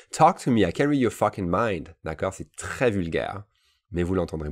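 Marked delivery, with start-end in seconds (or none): none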